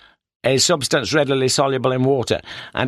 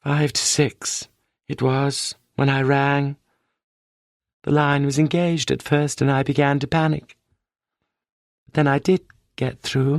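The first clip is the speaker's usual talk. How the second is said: distant sing-song, unreal and detached; 3 semitones higher than usual. Acted. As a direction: recite it like a dreamy memory. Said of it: "spoke in a sing-song voice".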